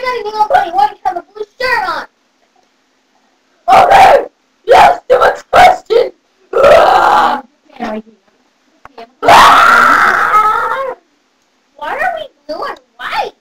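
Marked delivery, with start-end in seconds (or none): 3.7-6.1 loud, strained and exasperated; fed-up fairy voice. Acted. Bunny fairy sick of a child's questions, exasperated croak. 6.5-10.9 loud rough "ah"; exasperated scream. Acted. Bunny fairy fed up with questions, frustrated yell.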